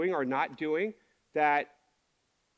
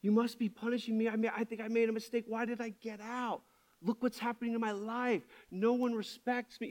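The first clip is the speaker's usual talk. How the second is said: acted-out anxious plea to God, pitched up; retold fearful prayer; 4 semitones higher, 5 dB quieter than usual. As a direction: plead upward in a higher, restrained voice. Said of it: "I felt a lot of fear".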